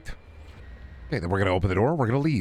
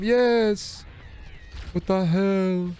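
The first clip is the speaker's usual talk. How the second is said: high exclamation; 9 semitones higher, much slower than usual. Real, not acted.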